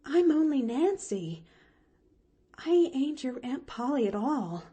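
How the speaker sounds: put-on plain-spoken servant girl setting things straight; hired girl Nancy. Hired girl correcting Pollyanna, lightly voiced servant.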